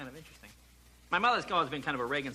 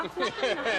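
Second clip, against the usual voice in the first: high, coy brush-off; innocent mom denial; 6 semitones higher than usual. Retold or imitated. Mother denying her hint, in high coy voice. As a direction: play the mother backing off coyly.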